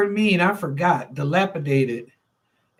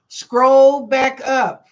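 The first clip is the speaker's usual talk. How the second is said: slow and louder, every word spelled out; drawn-out firm reminder to the chat; slow emphatic order; 4 semitones higher, 6 dB louder, much slower than usual. Shown as a demonstration. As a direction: lay down the rule slowly and firmly. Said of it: "How I wanna do this".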